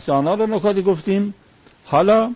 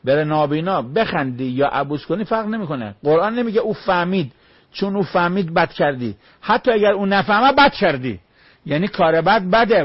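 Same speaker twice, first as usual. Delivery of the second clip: ordinary delivery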